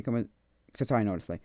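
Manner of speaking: normal talking voice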